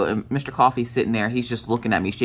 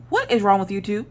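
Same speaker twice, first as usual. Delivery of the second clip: raised-pitch, puzzled motherly question; mildly exasperated mom voice; retold baffled mom; 7 semitones higher than usual. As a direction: lightly imitate a mother questioning her daughters. Mom scolds daughters on video chat.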